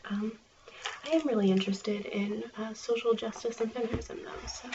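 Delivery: ordinary talk, nothing marked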